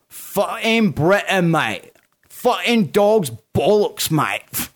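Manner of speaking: slow, high, hammed-up British accent; badly faked, stretched-out British mate-speak; terrible British accent. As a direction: do the worst British accent, proudly overdone. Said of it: "It's like the worst accent".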